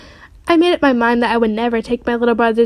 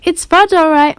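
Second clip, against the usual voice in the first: higher, lilting echo; mother echoing; 6 semitones higher, 3 dB louder than usual. A narrator's reading. Mother echoing her son's words, higher voice. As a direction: repeat it back higher, as the mother. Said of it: "my mother repeated".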